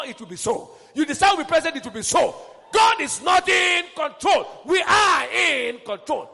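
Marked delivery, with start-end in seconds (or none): 2.7-6.3 slow, loud, ringing declaration; full-force preaching. Real, not acted. Preacher to his church, declaring they are in control. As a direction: proclaim it like a battle cry.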